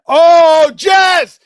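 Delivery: sudden high, loud cheer at a home run. Real, not acted. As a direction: yell out the player's name in delight. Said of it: "he's cheering for the Yankees".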